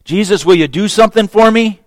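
a man demanding a favour; the petitioner pressing Jesus; demanding request. Retold or imitated. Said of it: "now the tables are turned".

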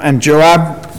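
normal talking voice

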